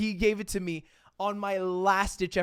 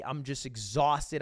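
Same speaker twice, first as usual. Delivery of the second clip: ordinary talk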